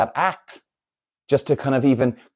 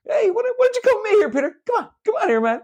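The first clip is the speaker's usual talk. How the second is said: high, rapid put-on voice; mock coaxing; faster than usual. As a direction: coax in a high pretend voice. Acts out orderly coaxing Peter away.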